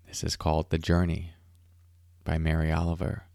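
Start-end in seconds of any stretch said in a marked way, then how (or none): none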